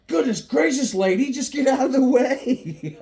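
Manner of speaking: high, loud scolding holler; hammed-up public scolding; mock exasperation. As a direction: yell it in put-on impatience, breaking into laughter. Acts scolding mom in the store.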